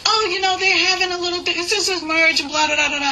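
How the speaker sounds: put-on high, chatty New York accent; New York toll worker. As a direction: rattle it off like a local toll attendant. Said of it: "that's the way everyone talks".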